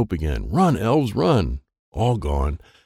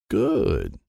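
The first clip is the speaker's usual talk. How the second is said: ordinary delivery, nothing marked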